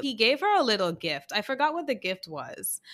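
normal talking voice

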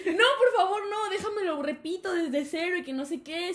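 high-pitched begging, easing toward the end; stretched-out imitated plea; mock pleading. Boy asking to redo the exam, mock pleading.

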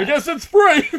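high, loud laughing mimicry; mocking customer quote. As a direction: parrot the customer's dumb joke, laughing. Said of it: "I hate when people say that".

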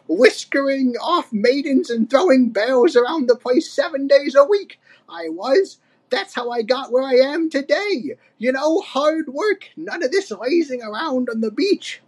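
put-on high-pitched old-timer boasting; Cranky, proud. A narrator's reading. Old ape bragging about hard work, proud and scolding. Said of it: "said Cranky proudly".

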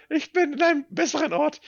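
high, ghostly, dreamy voice; mock dying. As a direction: play it as drifting away. Mock dying on dentist chair.

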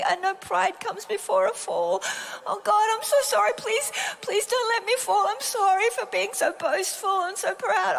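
hammed-up, high-pitched begging for mercy; mock-panicked repentance, rising to a tearful laughing plea; frantic pleading. Speaker re-enacts begging God's forgiveness for boasting, frantic pleading.

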